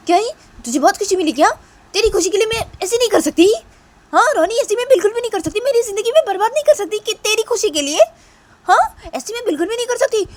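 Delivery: very high, loud, fast angry refusal; furious shouting. Acted. Sister refusing to ruin her life for Roni. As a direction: scream it out in a rage.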